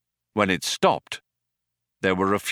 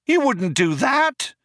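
very high, drawn-out protest; cried out. A narrator's reading. Trillian disputes the cop's threat, high cry. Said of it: "cried Trillian".

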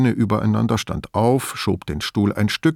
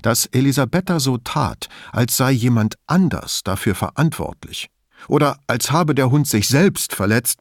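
ordinary delivery

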